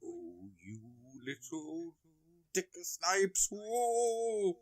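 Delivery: a hint of a startled lackey; mock exclaiming henchman. Imitating Stu as a clumsy henchman, rising surprise. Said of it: "And Stu would be like".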